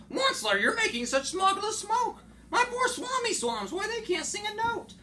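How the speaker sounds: high, loud reproachful Lorax; scolding Lorax. Acted. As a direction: scold him in a high fussy voice.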